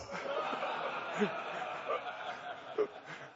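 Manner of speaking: breathy laughing cackle; gleeful laugh. Retold or imitated. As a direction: laugh at the fooled brother. Brother laughs at prank.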